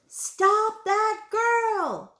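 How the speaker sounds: high, drawn-out witch's shout. A narrator's reading. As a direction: holler the order across the store. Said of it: "shouted the checkout witch".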